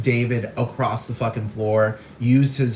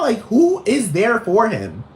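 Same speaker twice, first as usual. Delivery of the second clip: drawn-out sass; 8 semitones higher, slower than usual. Acted. Sassy repeat, drawn out.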